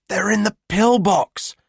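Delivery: put-on high, eager exclaiming boy; excited exclaim. A narrator's reading. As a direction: blurt it out excitedly. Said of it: "Tate exclaims".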